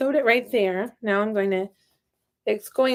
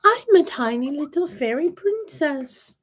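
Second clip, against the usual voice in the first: Peppa; 7 semitones higher than usual. Pretend. Voicing the toy Peppa.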